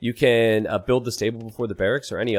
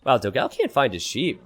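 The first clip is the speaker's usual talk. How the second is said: ordinary delivery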